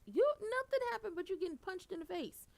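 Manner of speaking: very high, loud incredulous rant; high loud exasperation; exasperated rant. Real, not acted. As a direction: yell at her disbelief. Ranting at the character.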